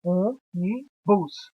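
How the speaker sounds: Ônibus said very slowly, syllable by syllable; split into syllables. Shown as a demonstration. Teacher showing one vowel per syllable, slowly. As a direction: sound out Ônibus in three slow beats. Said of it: "cada sílaba terá uma vogal".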